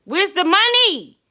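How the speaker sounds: pushed up high and loud, demanding the money; impatient demand. Retold or imitated. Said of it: "So I'm like".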